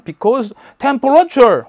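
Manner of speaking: high, loud, very slow hammed-up English; mock English speech. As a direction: deliver it like a goofy punchline. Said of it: "mock English answer". (과장된 영어 말투)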